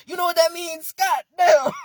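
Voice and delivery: loud, high, laughing; laughing outburst. Real, not acted. Reacting to own tongue twister, laughing outburst. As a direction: exclaim through laughter.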